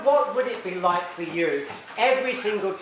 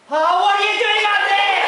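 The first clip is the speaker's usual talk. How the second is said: high, loud mock mum; mum calling up; 13 semitones higher, 8 dB louder than usual. Mum calling up to her child on the wardrobe, high and loud. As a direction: call up to the child like a startled mum. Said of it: "your mum would have been going".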